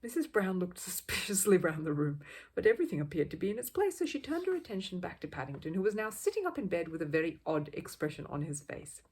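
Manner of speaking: ordinary delivery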